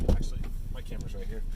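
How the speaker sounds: breathy hushed aside; whisper. Real, not acted. Aside while filming hail.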